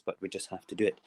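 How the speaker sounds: ordinary talk, nothing marked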